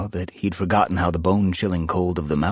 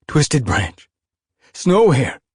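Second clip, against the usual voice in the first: happy greeting; 9 semitones higher, 7 dB louder, slower than usual. Acted. Greeting old friends happily.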